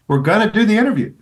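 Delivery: Denzel's big announcement. Retold or imitated. Host imitating Denzel agreeing to the interview, loud and high. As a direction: proclaim it like good news.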